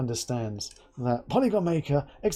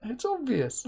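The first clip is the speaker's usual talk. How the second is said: imitating flustered colleague; 12 semitones higher than usual. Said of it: "He's like".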